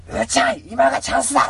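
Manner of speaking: rally the cat to attack; excited call. Pretend. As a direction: shout the chance, high and loud. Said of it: "っ".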